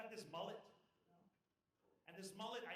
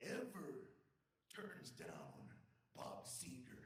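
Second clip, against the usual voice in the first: slow, dropping into a very low voice; mock-solemn declaration; 5 semitones lower, much slower than usual. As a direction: deliver it as a grave patriotic creed, pausing between phrases. Mock-patriotic punchline to agent.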